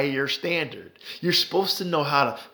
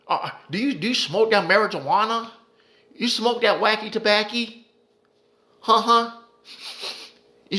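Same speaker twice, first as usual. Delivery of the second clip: mocking cop voice; 3 semitones higher than usual. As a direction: needle him like a smug officer, pitched up. Acting out cop harassing grieving citizen.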